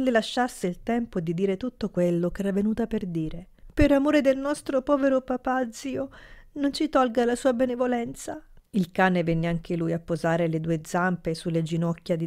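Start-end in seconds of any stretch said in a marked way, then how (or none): 3.7-8.4 higher, whining tearful plea; whimpering plea. A narrator's reading. Niece begs her uncle for kindness, whimpering. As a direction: beg in a whimpering voice. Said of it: "La voce piagnucolosa della bimba".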